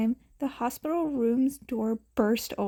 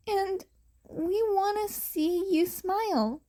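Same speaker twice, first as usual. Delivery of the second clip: gentle comfort; 9 semitones higher than usual. Acted. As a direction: reassure her tenderly. Comforting the child.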